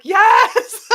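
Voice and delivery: a high, loud, laughing shout of yes; excited yes. Retold or imitated. Retelling an excited confirmation.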